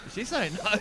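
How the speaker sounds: high, soft worried whisper-like question; hammed-up confused mate; mate's worried quote. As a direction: ask it like a stunned mate. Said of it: "my mate's going".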